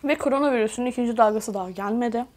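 chewing while speaking; muffled behind hand; talking with mouth full. Real, not acted. Seen 1: Ate just before; hand covers mouth throughout the labelled words.

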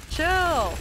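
high, loud exasperated cry; frantic high shout. Real, not acted. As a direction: yell it in panic. Repeating chill louder.

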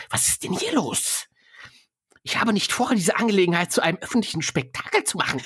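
fussy stockbroker, no longer hissed; put-on broker voice. Stockbroker voice, retake.

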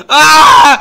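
high, very loud cry; mock panicked scream. Toy that can only go left, mock panicked scream. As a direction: scream as if stuck and helpless. Character pleads for help.

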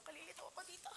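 very soft, whispered confusion; whispered aside. Real, not acted. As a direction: drop to a whisper as if to yourself. Confused aside to self while presenting.

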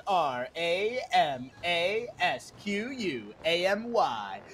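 slow spelling. Acted. Spelling out the candidate's name.

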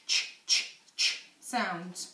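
ch said as a short unvoiced puff, repeated; phonics sound ch. Shown as a demonstration. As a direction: repeat the bare sound ch, ch, ch with no vowel. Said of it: "Where is the ch".

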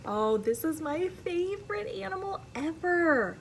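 bright rising excitement over a favourite; thrilled reader's gush; excited. Real, not acted. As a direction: gush about the best animal ever. Excited reading to child.

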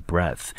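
"breath" with short e as in "bed"; the noun "breath", rhyming with "death"; breath, short vowel. Shown as a demonstration. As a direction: say "breath" with an open eh and soft th. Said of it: "一个是念成breath，a的音".

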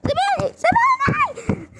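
very high, slow let-me-go wail; girl wailing to be let go during a play fight; mock pleading squeal. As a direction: wail to be released, hamming it up.

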